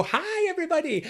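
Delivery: chirpy fake-confident hello; big brave face. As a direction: put on a brave cheery front. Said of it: "your big brave face comes on".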